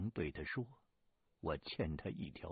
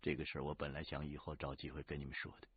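usual speaking voice

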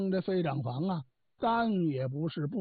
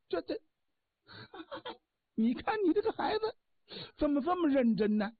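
high, breaking into laughter mid-sentence; laughing helplessly; 6 semitones higher than usual. Acted. Li Tieguai laughs at the stubborn child, voice going high with laughter. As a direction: laugh through the words at the stubborn boy.

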